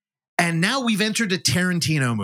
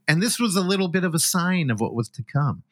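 ordinary talk

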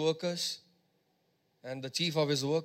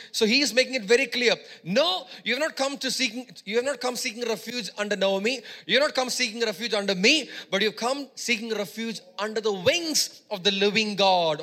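preaching at full force; 4 semitones higher than usual. Acted. Preacher declaring Ruth's choice to the congregation, full force. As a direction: proclaim it to the whole church.

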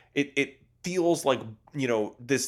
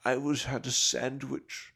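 Herzog impression; slower than usual. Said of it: "he just says".

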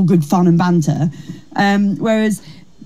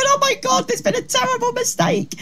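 high squeaky mock panic; 5 dB quieter than usual. Comedian recalling stage fright, mock panicked squeak.

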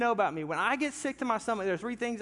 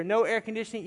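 ordinary talk

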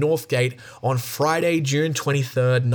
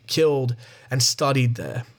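ordinary talk

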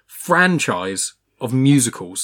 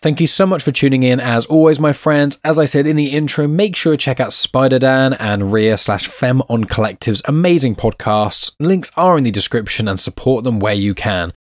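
louder, upbeat host wrap-up; podcast outro; 5 dB louder than usual. Acted. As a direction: sign off the show like a presenter.

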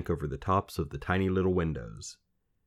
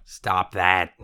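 louder croaky character voice; scolding little man; 6 dB louder than usual. A narrator's reading. Little man interrupting the girl's wailing, croaky scolding. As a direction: cut in gruffly on her lament. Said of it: "she heard the little man say".

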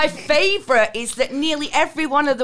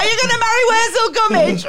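higher, louder cheeky yell from a window; mock kid's shout; 9 semitones higher, 5 dB louder than usual. Eight-year-old yells insult from mum's window, cheeky shout. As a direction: holler it like a cheeky kid.

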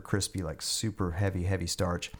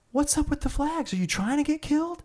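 yelling major. Retold or imitated. Major chewing out the soldier over flags, furious. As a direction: scream at the idiot soldier.

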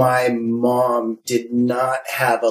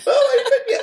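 put-on much higher, squeaky playground kid; mock lovestruck kid. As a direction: squeal it like a smitten schoolkid. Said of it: "when the boy liked you".

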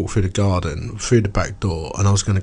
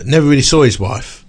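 ordinary talk, nothing marked

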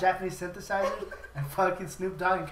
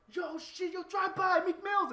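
much higher mock panicky voice; spooked thugs; 12 semitones higher, slower than usual. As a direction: squeal it like a frightened goon. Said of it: "they're like".

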